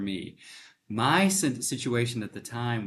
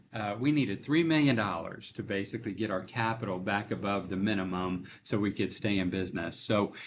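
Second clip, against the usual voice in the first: ordinary delivery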